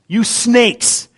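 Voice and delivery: "snakes" with a long drawn-out S sound; drag out the S. Acted. John the Baptist calling people snakes, S in "snakes" dragged out. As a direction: hold the S in "snakes". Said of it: "you always drag out the S".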